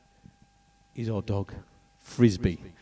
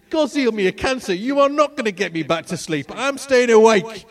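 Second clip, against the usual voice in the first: euphoric; 9 semitones higher, 5 dB louder than usual. Retold or imitated. Euphoric defiance after healing.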